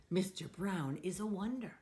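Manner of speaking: plain reading voice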